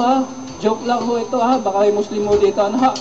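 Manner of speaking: ordinary talk, nothing marked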